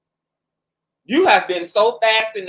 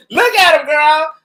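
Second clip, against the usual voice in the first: excited; 7 semitones higher, 6 dB louder than usual. Real, not acted. Hyped commentary on a clip.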